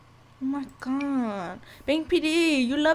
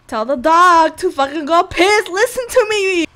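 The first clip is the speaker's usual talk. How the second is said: loud exasperated yell, higher at the end; frustrated yell; 5 semitones higher, 10 dB louder than usual. Real, not acted. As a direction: yell at them to just obey. Yelling at Sims to obey.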